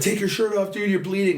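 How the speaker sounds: hammed-up frantic friend pitched high; urgent friend. Friends urging him, he's bleeding.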